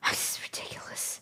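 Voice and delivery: hushed aside in disbelief; whisper. Real, not acted. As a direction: mutter it under the laughter. Whispered after laughing.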